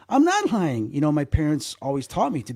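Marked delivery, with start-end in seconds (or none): 0.1-0.9 put-on mildly upset, higher-pitched kid's protest; tearful child's protest. Child denies lying to teacher, retold tearful protest. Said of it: "and I'm crying".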